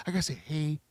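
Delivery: gentle, soft and low, a detective comforting a victim; softened voice. Shown as a demonstration. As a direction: ease off and speak softly, like to someone hurt. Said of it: "I gotta soften myself".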